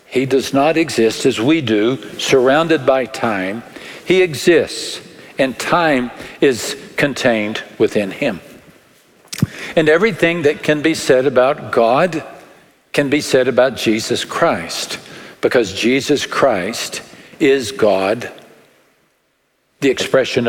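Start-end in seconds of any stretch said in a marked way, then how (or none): none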